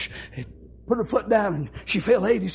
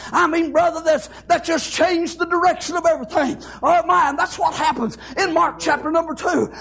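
preaching at full force; 4 semitones higher than usual. Acted. Pastor preaching to his congregation about the Holy Ghost, full-force preaching. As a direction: drive it home like a fired-up country preacher.

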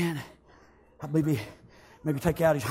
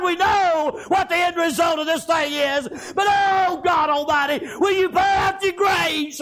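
fervent shouted prayer; 9 semitones higher, slower than usual. Acted. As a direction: pour it out like a desperate intercessor. Grandparents praying aloud for boy.

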